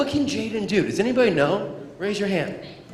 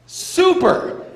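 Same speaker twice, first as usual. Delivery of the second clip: high, loud cheer of super; excited cheer; 12 semitones higher, 8 dB louder than usual. Shown as a demonstration. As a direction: praise him with a big high cheer. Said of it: "Anytime Jaden does something super".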